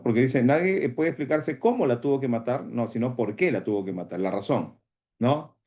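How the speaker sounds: usual speaking voice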